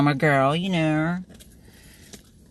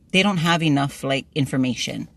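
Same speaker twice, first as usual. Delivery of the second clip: ordinary delivery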